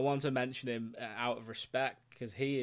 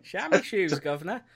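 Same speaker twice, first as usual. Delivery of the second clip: jokey, higher-pitched Cockney; mock Cockney accent; 5 semitones higher, 4 dB louder than usual. Podcaster answering jibes about his accent, hammed-up Cockney. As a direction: ham up a terrible Cockney accent. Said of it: "the worst Cockney accent".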